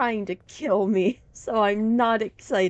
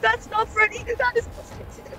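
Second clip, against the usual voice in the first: scared horror game streamer; 14 semitones higher than usual. Real, not acted. Horror game, sees changed Freddy.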